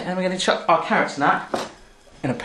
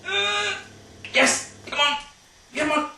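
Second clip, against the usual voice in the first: high, soft strained urging; willing it on in a small, high voice; straining effort; 11 semitones higher, 8 dB quieter, slower than usual. Pretend. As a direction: squeeze the words out under mock effort. Straining to lift carrots in pan.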